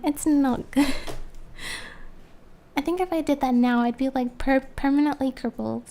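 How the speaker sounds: ordinary talk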